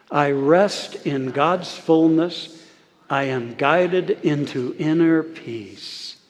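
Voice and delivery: slow, unhurried, every word stretched; slow group recitation. Shown as a demonstration. Minister saying the affirmation in unison with the congregation, slowly. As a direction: say it together with them, slowly. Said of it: "Let's say that together".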